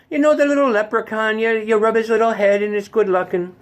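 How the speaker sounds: high, lilting Irish guy; Irish accent. Acted. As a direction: banter like an Irishman, high-pitched. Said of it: "a really good Irish guy".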